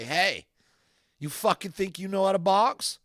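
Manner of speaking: loud high taunting challenge; provoking shout. Retold or imitated. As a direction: pick a fight out loud. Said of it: "I was like".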